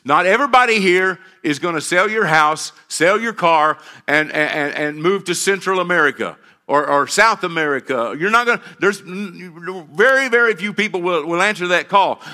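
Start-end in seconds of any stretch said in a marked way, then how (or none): none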